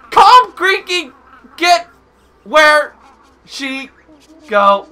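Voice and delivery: loud, isolated barked words; man of few words. Acted. Player voicing few-worded game character, loud separate words. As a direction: shout one word at a time. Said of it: "He only says, like, a few words".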